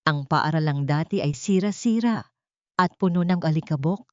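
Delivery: usual speaking voice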